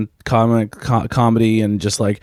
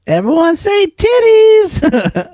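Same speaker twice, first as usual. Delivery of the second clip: much higher, loud, slow shout; yelled punchline; 4 dB louder, much slower than usual. Retold or imitated. Dad on a ski lift family photo, slow loud shout. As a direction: bellow it out like a cheeky dad. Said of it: "he goes".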